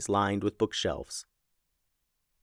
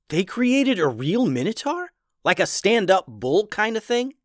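much higher, wide-eyed disbelief; amazed kid; 9 semitones higher than usual. Acted. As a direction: gasp it out, astonished child. Unica amazed.

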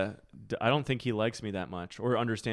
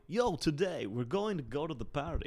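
slow mock Dutch accent in English; playful Dutch accent in English, for fun; 4 semitones higher, 3 dB quieter than usual. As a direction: lay on a Dutch accent, slowly. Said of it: "speak in Dutch accents in English".

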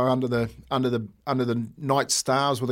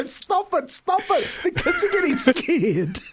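much higher pleading voice, laughing through it; imitating panicked teachers; slower than usual. Retelling the teachers begging him to stop, high laughing mock panic. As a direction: squeak it out like a flustered teacher. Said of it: "the teachers run up to me and go".

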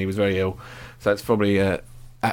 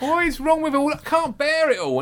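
high, slow, fed-up complaint; exasperated; 10 semitones higher than usual. Real, not acted. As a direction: sound at the end of your tether. Fed up with injury excuses.

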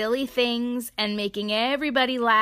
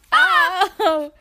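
ordinary delivery, nothing marked

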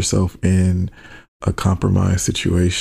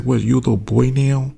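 a hint of a teasing Pandora, pitched higher; mock Pandora taunt; 5 semitones higher than usual. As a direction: taunt him lightly like Pandora. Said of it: "Pandora's like".

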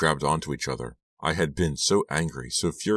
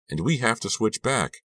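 high-pitched urgent plea; trembling voice; 8 semitones higher than usual. A narrator's reading. Girl demands her body back, high distressed plea. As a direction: insist on it, pitch lifted in distress. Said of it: "repeated in a trembling voice".

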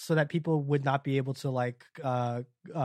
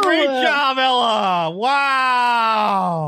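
loud, high cheer for a friend; excited cheering; 4 semitones higher, 7 dB louder, much slower than usual. Real, not acted. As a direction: cheer her on, thrilled. Cheering a correct answer.

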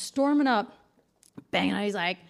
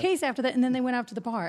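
ordinary talk, nothing marked

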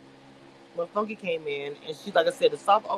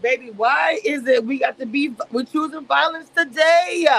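mock disbelief; 6 semitones higher, 6 dB louder than usual. Her own retold plea.

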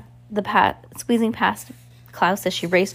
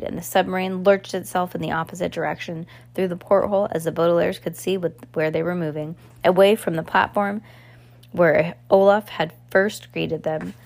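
ordinary delivery, nothing marked

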